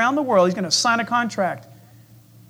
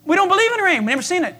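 high scoffing crowd voice; a hint of a mocking scoffer; 8 semitones higher, much faster than usual. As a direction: brush him off, sneering high. Scoffers answer Noah.

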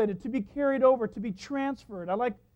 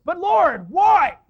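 high, shouted mock plea to God; anguished protest; 7 semitones higher, 14 dB louder, much slower than usual. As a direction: cry out in hammed-up complaint. Acting believer protesting to God.

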